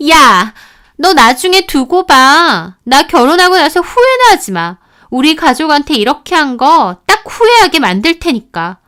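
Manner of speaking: sister-in-law threatening the wife before hanging up; threatening yell. Retold or imitated. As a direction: promise revenge, loud and venomous.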